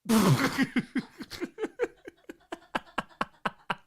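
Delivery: high laugh tapering off; long laugh. Real, not acted. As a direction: laugh hard until it trails away. Laughing at the footage.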